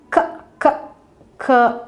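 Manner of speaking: "K" as short separate kuh bursts, not kay; letter sound. Shown as a demonstration. Teacher shows how candle sounds at the start, letter sound. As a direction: sound out "K" three times, spaced apart. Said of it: "and it sounds".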